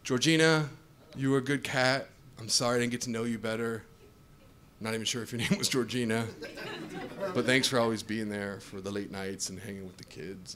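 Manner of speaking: low, soft graveside farewell; emotional eulogy. Acted. Owner saying goodbye at his cat's grave, emotional and subdued. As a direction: deliver a hushed mock eulogy to a pet. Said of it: "Now I'm emotional".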